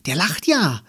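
high, loud astonished exclamation; surprised. A narrator's reading. Little brother seeing the painted face, surprised. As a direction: blurt it out in amazement. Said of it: "rief Magnus, ganz überrascht".

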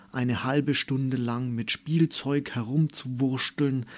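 ordinary delivery

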